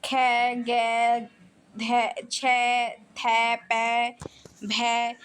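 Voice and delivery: खे, गे, धै, छै, थै, पै, भै sounded out slowly with a strong puff of air; breathy aspirated syllables. Shown as a demonstration. Teacher demonstrating aspirated sounds to students, slow and breathy. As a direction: drag out each syllable and let the breath burst out. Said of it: "अधिक हवा निकलती है".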